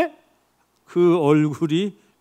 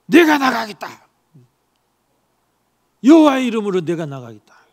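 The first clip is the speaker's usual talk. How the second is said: bold declaration; 3 semitones higher, 5 dB louder than usual. Acted. David volunteers to fight Goliath.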